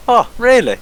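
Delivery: high mock-surprised oh really; sarcastic. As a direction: fake delight, sarcastic. Sarcastic retold reply.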